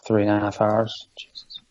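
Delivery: exhausted, drops to a whisper; breathed out, worn out after hours; tired whisper. Real, not acted. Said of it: "I'm really knackered".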